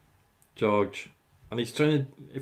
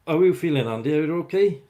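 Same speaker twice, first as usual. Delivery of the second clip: cheery call-out; 6 semitones higher, 9 dB louder than usual. Real, not acted. Calling out to viewer Andy.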